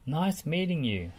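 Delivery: ordinary delivery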